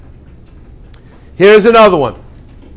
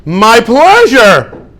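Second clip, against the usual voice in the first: much higher and louder, slow and effusive; gushing eager helper; 11 semitones higher, 5 dB louder, slower than usual. Shown as a demonstration. Teacher models the right answer to thanks, much higher and gushing. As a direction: overflow with delight at being asked.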